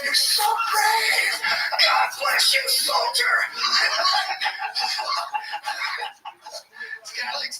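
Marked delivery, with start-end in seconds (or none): 0.0-3.3 high, slow, syrupy fake admiration; sarcastic mock praise. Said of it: "It's like". 3.4-7.0 high laugh. Real, not acted. Laughing at own joke.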